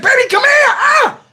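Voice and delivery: very high, wailing shout; acted desperate plea. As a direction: holler for help, hammed-up agony. Pleads to partner upstairs.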